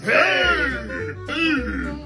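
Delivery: normal talking voice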